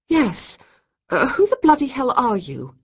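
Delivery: high, loud flustered demand; indignant demand. A narrator's reading. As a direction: demand it, caught off guard. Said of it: "Kate demanded".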